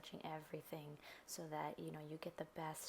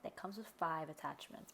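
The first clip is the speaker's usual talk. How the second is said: ordinary talk, nothing marked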